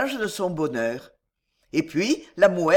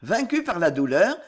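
ordinary delivery, nothing marked

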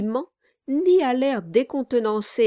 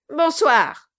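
loud, high, abrupt goodnight; curt dismissal; 4 semitones higher, 5 dB louder than usual. A narrator's reading. Dwarf sends the lawyer away, curt and loud. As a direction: dismiss him with one forceful word. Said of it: "dit le nain avec un geste expressif".

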